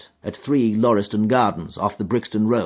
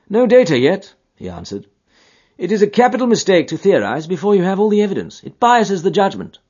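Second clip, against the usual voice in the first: higher, louder, lecturing detective; Holmes voice; 9 semitones higher, 5 dB louder than usual. A narrator's reading. Holmes lecturing Watson in the cab, higher firm voice. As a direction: pronounce it like a rule of method.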